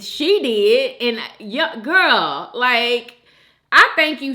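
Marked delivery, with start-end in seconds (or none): none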